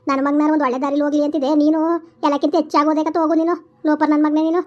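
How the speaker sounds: loud furious cursing; angry scolding. Acted. Mother loudly curses son and bans him from the house. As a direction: disown him, shouting.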